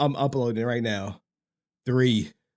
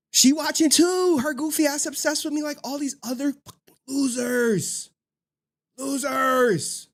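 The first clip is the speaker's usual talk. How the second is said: mocking impression; 11 semitones higher than usual. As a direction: sneer it like the guy. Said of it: "He like".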